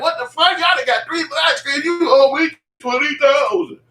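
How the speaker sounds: very high, laughing, outraged yell; mock outrage. Creditor seeing the flat screens, high laughing mock outrage. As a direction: squeal in disbelief.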